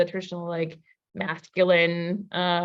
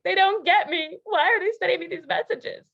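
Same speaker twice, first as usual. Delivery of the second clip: high, louder whiny complaint; mock whine; 9 semitones higher, 4 dB louder than usual. A turned-off partner complaining about the texts, high mock whine. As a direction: whimper like a hurt partner. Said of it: "instead of being like".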